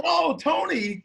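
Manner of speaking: high, chuckling oh through a laugh. Retold or imitated. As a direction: laugh it off fondly. Amused at Tony's mistake.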